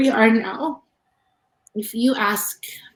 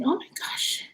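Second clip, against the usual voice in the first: whisper; 7 semitones higher, 8 dB quieter than usual. Retold or imitated. Reacting to partner's death.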